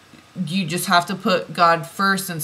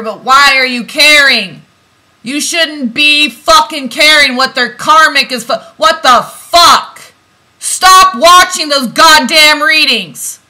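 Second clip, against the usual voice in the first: louder, higher, exasperated yelling at viewers; angry rant; 7 semitones higher, 12 dB louder than usual. Real, not acted. Reader scolding divine feminine viewers for watching readings, angry shout. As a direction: let the frustration boil over into shouting. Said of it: "I'm getting angry".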